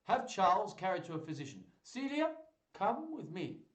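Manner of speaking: high, peremptory orders; commanding duke. Acted. Angry duke giving orders.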